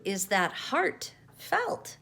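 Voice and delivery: "is that heart felt?" drawn out slowly with a rise on "felt"; slow careful question. A narrator's reading. Roo carefully rephrasing his question to Pooh. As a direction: spell out the pun slowly, rising on "felt". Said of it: "said Roo".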